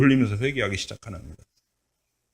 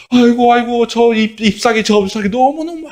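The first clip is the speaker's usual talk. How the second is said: lamenting; 11 semitones higher than usual. Acted. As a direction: cry out over the lost leaf. Jonah grieving the plant.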